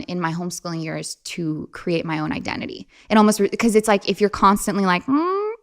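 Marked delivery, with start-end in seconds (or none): 5.1-5.5 high, eager-to-please plea; acted needy over-eagerness; anxious people-pleasing. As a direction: beg for approval in a high voice. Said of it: "this deep people-pleasing habit".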